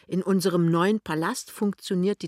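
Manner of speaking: ordinary delivery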